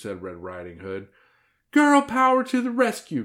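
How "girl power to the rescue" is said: put-on very high, loud girlish battle cry. A narrator's reading. Hilary sets off to save grandma, crying out. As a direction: shout it like a child superhero. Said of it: "cried Hilary".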